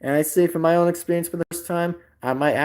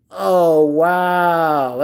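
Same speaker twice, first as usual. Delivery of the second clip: usual speaking voice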